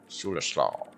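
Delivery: ordinary delivery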